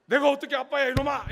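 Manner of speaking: loud pretend rebuke of the clinging toddler; hammed-up protest, half laughing; mock outrage, loud; mock scolding. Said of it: "이러면서 막 이렇게 할 수는 없잖아요".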